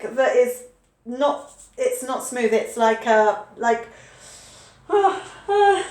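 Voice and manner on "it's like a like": ordinary delivery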